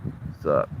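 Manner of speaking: whispered cool-cat greeting; breathy whispered cat line. Retold or imitated. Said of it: "They're like".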